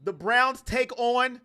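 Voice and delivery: steady game-call delivery; light sportscaster narration; play-by-play call. Acted. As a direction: call the plays like a broadcaster.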